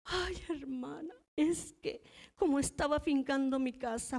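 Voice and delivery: lightly voiced weepy confession; mock tearful plea. Caught treasurer confessing to her pastor, mock crying. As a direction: whine it out through mock tears. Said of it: "Se agarró llorando".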